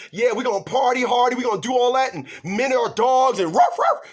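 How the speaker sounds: hammed-up sassy woman who barks like a dog; mocking party girl. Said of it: "played that old ... game".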